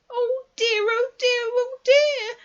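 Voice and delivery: high, loud squeaky worry; slow, high-pitched wail of dismay; fretful Piglet. A narrator's reading. Piglet to himself near the trap, fretful high wail. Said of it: "said Piglet to himself".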